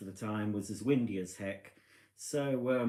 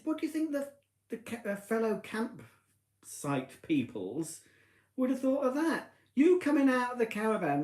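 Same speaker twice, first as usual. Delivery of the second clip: high-pitched mock scolding; telling off; 11 semitones higher than usual. Wife scolding husband about campsite neighbours, high pitch. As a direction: scold him in a much higher voice.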